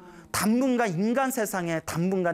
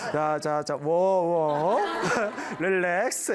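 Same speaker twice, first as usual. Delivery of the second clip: mock soothing; 3 semitones lower, much slower than usual. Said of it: "흥분하지 말고".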